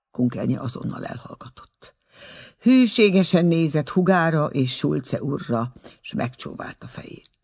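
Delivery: usual speaking voice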